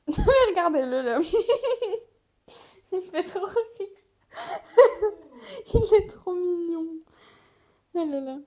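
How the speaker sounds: giggling, high and loud; laughing. Real, not acted. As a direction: laugh through the words. Cooing over something cute.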